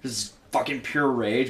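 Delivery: ordinary talk, nothing marked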